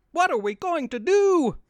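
high, loud panicked cry; panicked scream. Acted. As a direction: scream it in terror.

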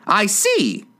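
high-pitched queen's retort; high, haughty royal voice; imperious queen. A narrator's reading. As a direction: say it as a screechy monarch. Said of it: "said the Queen".

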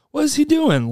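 mock bewildered question, pitched high; puzzled high quote. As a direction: voice jumps up high, baffled. Retelling a stranger staring him down.

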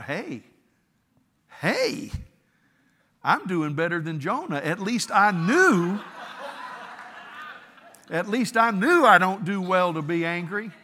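loud, laughing self-congratulation; smug self-talk. Retold or imitated. Preacher congratulating himself over Jonah, loud and laughing. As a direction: crow about it with a chuckle. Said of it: "Then I thought".

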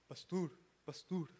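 put-on adolescent voice, kept low; teenager. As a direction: imitate a teen calling the pastor. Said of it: "Así hablan los adolescentes".

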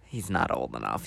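very low, hushed retold aside; quiet, low murmur; discreet aside. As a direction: drop right down, as if tipping off a waiter.

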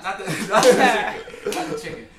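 laughing through the words; cracking up mid-sentence. Real, not acted. Laughing while correcting himself.

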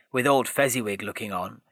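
usual speaking voice

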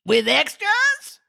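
high, slow, worried crooning; anxious jazz scat. A narrator's reading. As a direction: croon the question nervously. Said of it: "asked the Mock Turtle a little anxiously".

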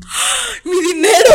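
high, loud cry of dismay; mock-dismayed exclamation; shocked. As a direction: react high and loud to being overcharged. Shocked at being overcharged.

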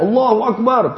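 high ringing Allahu Akbar; takbir call. Retold or imitated. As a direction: proclaim it like a marching army. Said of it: "takbir semua".

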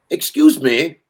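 put-on loud, slow, high British accent; drawn-out British "excuse me"; British character. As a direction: cut in politely as a put-on Brit. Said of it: "this British character".

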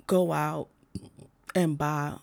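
words broken by a burp. Real, not acted. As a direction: burp slips out mid-sentence. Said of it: "what the fuck I'm burping?".

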